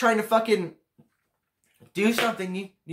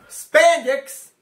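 disgusted shout; 8 semitones higher, 8 dB louder than usual. Real, not acted. Disgusted outburst at the woman.

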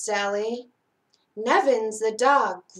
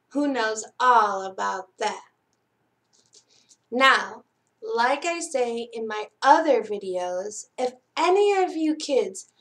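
plain reading voice

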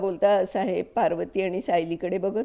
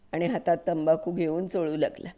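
usual speaking voice

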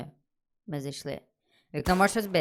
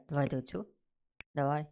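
normal talking voice

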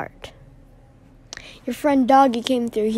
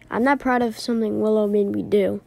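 normal talking voice

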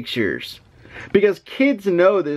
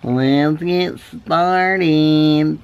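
slow drawn-out; much slower than usual. Shown as a demonstration. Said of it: "always sound like a robot".

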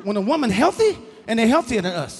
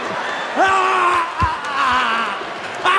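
mock grunts; 8 semitones higher, much slower than usual. Acting the ugly lover.

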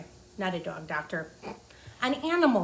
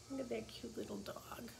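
hushed, half to herself over the picture; soft aside; 15 dB quieter than usual. Real, not acted. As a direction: murmur it while showing the page.

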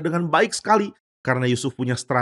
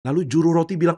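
plain reading voice

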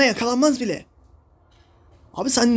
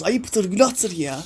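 slow scolding; 2 semitones lower, much slower than usual. Real, not acted. Gamer scolding his teammates.

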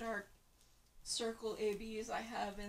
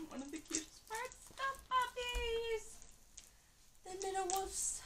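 gushing excitement; 12 semitones higher than usual. Real, not acted. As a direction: coo it like you just saw puppies. Gushing over the cute puppies.

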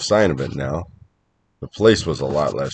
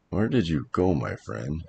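hushed muttering to oneself; mumbling to self; 8 dB quieter than usual. Acted. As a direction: murmur it privately under your breath. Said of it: "I mumbled to myself".

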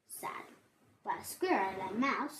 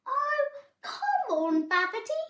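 Mouse's coaxing; 13 semitones higher, 6 dB louder than usual. A narrator's reading. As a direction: draw it out pleadingly, pitched up. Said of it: "said Mouse".

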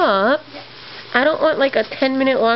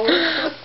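delighted gasp; 6 semitones higher than usual. Real, not acted. Seeing popcorn egg.

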